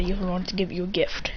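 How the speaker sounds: hushed unvoiced aside; a quick secret whisper to Mom. A narrator's reading. Secret aside about Dad.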